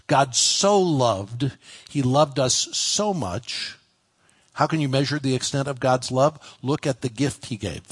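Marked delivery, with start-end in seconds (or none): 0.1-1.5 slow, with "so" stretched out; drawn-out, emphatic "so"; stress on SO. Shown as a demonstration. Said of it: "put the emphasis of the verse". 1.9-3.8 slow, with weight on "so"; drawn-out "so" before "much"; stress on SO. Shown as a demonstration. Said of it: "put the emphasis of the verse".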